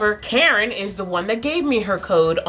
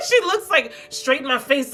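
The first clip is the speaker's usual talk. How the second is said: talking through a laugh, higher; 9 semitones higher than usual. Real, not acted. Recalling the coworker's look to viewers, laughing. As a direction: let laughter break into the words.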